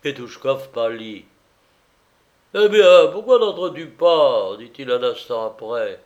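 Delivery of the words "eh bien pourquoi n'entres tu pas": loud, higher invitation; tipsy, impatient question; calling out. A narrator's reading. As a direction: call her in from the next room. Drunk man calls woman inside.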